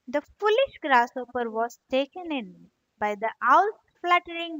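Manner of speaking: put-on soft, gentle storytelling for children; story narration. A narrator's reading. Teacher reading a fable aloud to kids, soft storytelling.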